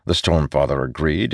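ordinary delivery

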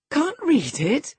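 astonished cry, stretching read; incredulous cry. A narrator's reading. Catherine to Hareton, high incredulous cry. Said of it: "cried Catherine".

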